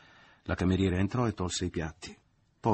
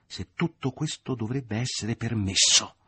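louder, slower, voice quivering with anger; rising trembling voice; 4 semitones higher, 5 dB louder than usual. A narrator's reading. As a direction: let the fury break through, trembling. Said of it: "la voce era già più alta, vibrava".

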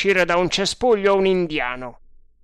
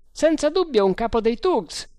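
high-pitched, emphatic sergeant voice; confident sergeant; 9 semitones higher, 4 dB louder than usual. A narrator's reading. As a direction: answer high and sure of it. Voce del sergente Bharata nel dialogo. (voce acuta e decisa da sergente)

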